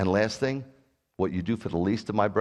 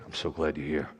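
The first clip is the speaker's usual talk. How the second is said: tender; 7 semitones lower, 5 dB quieter than usual. Retold or imitated. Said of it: "God will just say".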